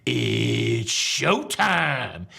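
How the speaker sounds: very slow, drawn-out announcer impression; loudspeaker announcer. Imitating the dining room loudspeaker, drawn-out announcer voice. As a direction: stretch it out like a showy announcer. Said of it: "I heard it over the loudspeaker".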